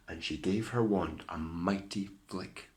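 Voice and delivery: ordinary delivery, nothing marked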